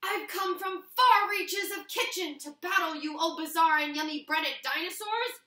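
proud sword master challenging her foe; heroic Scissors. Acted. Scissors the sword master hails the dinosaur nuggets, heroic and bold.

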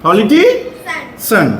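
Politician said very slowly, syllable by syllable; slow modelling. Shown as a demonstration. Teacher repeats politician slowly for the pupil. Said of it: "এটা politician".

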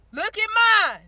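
ordinary talk, nothing marked

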